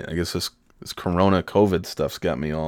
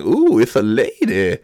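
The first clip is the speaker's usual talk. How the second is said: Ladies Man quote; 4 semitones higher, 5 dB louder than usual. Retold or imitated. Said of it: "quotes from the Ladies Man movie".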